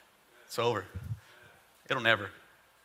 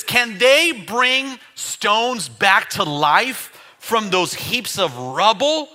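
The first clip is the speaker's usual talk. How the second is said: slow mocking taunt; 3 semitones higher, 8 dB louder, much slower than usual. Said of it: "He says".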